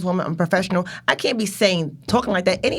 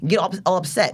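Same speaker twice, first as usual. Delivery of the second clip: normal talking voice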